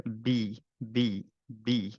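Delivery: বি drawn out slowly, going down; low bi. Shown as a demonstration. Teacher modelling বি with the sound going down. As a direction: stretch বি low and clear. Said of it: "নিচে যেতে হবে".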